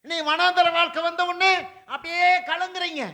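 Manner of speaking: loud, climbing sermon proclamation; fiery preaching. Acted. Pastor thundering to the congregation about the furnace, loud and fervent. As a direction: preach it out with rising fire.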